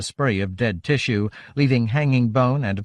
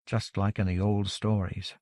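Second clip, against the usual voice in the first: soft, lowered voice, thinking to himself; inner thought; 3 semitones lower, 8 dB quieter than usual. A narrator's reading. Theo thinks of old tales, a soft inward murmur. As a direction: keep it hushed and private. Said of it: "Theo thought".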